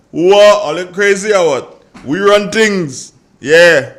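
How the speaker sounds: loud, high, playful mock outrage; big proud radio shout; excited outburst. Real, not acted. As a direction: crow with hometown pride. Host erupts with playful indignation.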